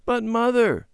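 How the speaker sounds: put-on child protesting to his mother. A narrator's reading. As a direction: object to Mother sharply. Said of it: "Randy protested".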